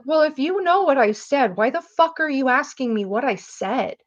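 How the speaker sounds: high-pitched, acted-out back talk; retold cheeky, indignant challenge; indignant retort. Acted quarrel with vice principal.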